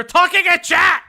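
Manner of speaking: sudden loud yell at his co-host; shout. Real, not acted. Streamer yelling at co-host hogging the chat, full shout. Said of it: "Why are you screaming?".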